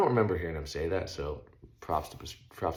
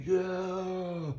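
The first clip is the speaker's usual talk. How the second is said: high excited whoop; cheering; 13 semitones higher than usual. Retold or imitated. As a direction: yell it out for your buddy's lap. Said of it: "Just cheering".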